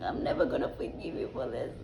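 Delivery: mock out-of-breath protest, voice thinning out; weak, wheezy mock scolding; imitating breathless indignation. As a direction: act cheeky while struggling for air. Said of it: "I just couldn't breathe".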